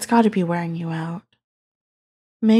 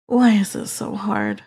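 normal talking voice

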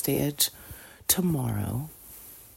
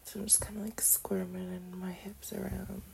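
soft whisper; 6 semitones higher, 9 dB quieter than usual. Real, not acted. Aroused, moving in bed.

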